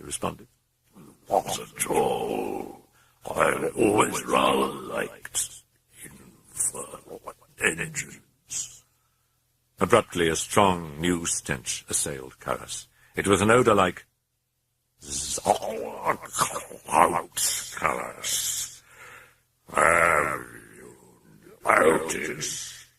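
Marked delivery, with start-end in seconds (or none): 1.3-8.8 slow, low, breathy demon voice; sinister murmuring demon. A narrator's reading. Demon answers calmly. 15.0-22.9 sinister murmuring demon. A narrator's reading. Demon taunts about the smell.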